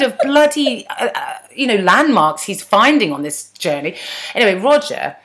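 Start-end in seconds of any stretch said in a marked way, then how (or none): none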